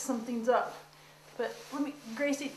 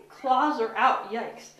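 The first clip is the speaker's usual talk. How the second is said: ordinary delivery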